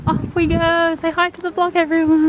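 bright, upbeat call to friends. Real, not acted. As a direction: cheer them into greeting. Arriving at Disneyland Paris.

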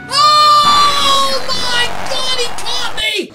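very loud, high, drawn-out scream; panicked scream. Real, not acted. Homer suddenly catches the player.

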